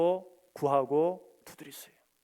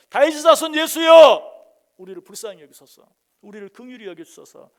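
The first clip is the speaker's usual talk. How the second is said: louder cry; 5 semitones higher, 19 dB louder than usual. Retold or imitated. Blind men shouting louder despite the rebuke, desperate. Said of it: "오히려 더 크게 소리를 지릅니다".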